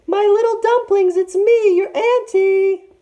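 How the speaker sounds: very high, slow sing-song falsetto. A narrator's reading. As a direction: squeak it out like a sweet old auntie. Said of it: "he said again in his high, high voice".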